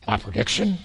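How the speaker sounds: low, soft menacing boxer voice; deep Clubber Lang growl, a bad imitation; bad Clubber Lang impression. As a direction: growl it out like Mister T, half-hearted. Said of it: "that's a bad voice".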